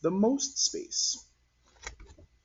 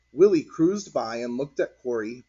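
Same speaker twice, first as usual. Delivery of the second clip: ordinary delivery, nothing marked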